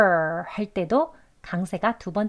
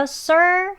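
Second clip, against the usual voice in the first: "sir" drawn out, higher; stressed syllable; 9 semitones higher, 4 dB louder than usual. Shown as a demonstration. Teacher marking the front stress, "sir" high and long. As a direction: lift and hold "sir" as the strong beat. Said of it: "강세 여기 앞에 있습니다".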